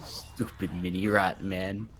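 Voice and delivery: low, slow brute grumble; grumbling bugbear. Acted. Bugbear to the rat on his shoulder, low grumble.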